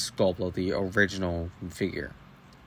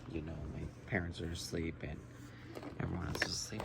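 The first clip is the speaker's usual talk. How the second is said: hushed, much softer voice; quiet at night; 3 semitones lower, 14 dB quieter, faster than usual. Real, not acted. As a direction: speak low so nobody wakes. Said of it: "I'm trying to stay quiet".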